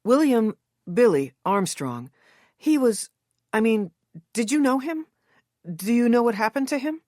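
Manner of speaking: nervous, urgent questions to a stranger; eager rush. A narrator's reading. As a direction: blurt it out before he leaves. Narrator pleads with reluctant actor.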